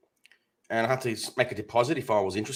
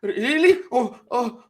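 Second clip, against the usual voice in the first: high, rising imitation of an upset woman; mock emotional; 10 semitones higher, 4 dB louder, slower than usual. Telemarketer moved by the sob story, imitated as emotional. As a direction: squeak it out like a caller getting emotional. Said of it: "she started getting emotional".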